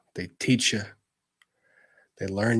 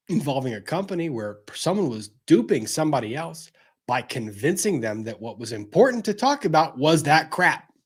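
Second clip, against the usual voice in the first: ordinary delivery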